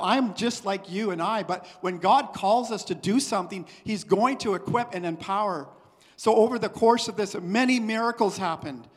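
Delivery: full-voiced sermon delivery; preaching. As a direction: preach it to the congregation. Preaching with force.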